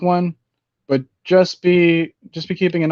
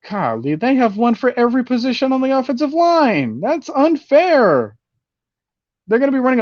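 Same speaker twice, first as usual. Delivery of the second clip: excited whine; 10 semitones higher than usual. Real, not acted. Marvelling at Tampa's stacked roster.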